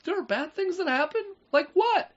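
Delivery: put-on very high, rising, wide-eyed child; naive child voice. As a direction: squeak it like a clueless kid.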